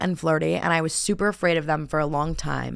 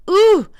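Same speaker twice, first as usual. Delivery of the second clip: high, loud yelp of discomfort; sudden high "ooh" in mock pain; pained wincing "ooh"; 10 dB louder than usual. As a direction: wince aloud as if it hurts. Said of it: "like, wincing in pain".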